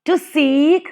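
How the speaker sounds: light "to", strong "seek"; iambic stress. Shown as a demonstration. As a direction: lean on "seek" in "to seek". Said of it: "unstressed and stressed".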